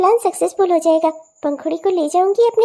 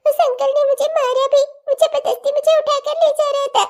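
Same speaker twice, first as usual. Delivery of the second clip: put-on higher, tearful child telling on her mother; a small girl tattling through tears; tearful little girl; 7 semitones higher than usual. Daughter tells father what mother did, tearful child voice.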